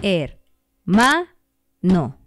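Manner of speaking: hermano split into er, ma, no with pauses; syllable split. Shown as a demonstration. Teacher splitting hermano for the class. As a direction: beat out er, ma, no as three beats. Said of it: "Son tres golpes de voz".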